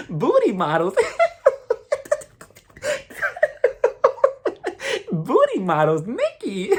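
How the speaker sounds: high, drawn-out fake laughing fit around the words; mock someone stalling with squealing, uneasy giggles; imitated nervous laughter. Wendy dodging Nikki's awkward question, exaggerated nervous laughter. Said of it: "The whole nervous laughter".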